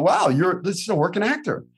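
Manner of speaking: high, fast, impressed exclamation; impressed casting director. Retold or imitated. Casting director marveling at a real actor. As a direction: gush over a working actor. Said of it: "I'm gonna be like".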